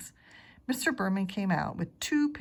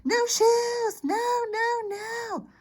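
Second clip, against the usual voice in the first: shrieked much higher and louder; screaming tantrum; 6 dB louder, much slower than usual. Acted. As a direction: scream it like a toddler in a full tantrum. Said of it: "He screamed".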